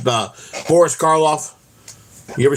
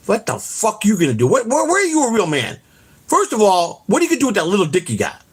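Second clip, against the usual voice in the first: high, heated taunt at the rival; mocking outrage; 7 semitones higher than usual. Real, not acted. Ranting at Quad, taunting him, high and heated. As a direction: sneer it up high, building heat.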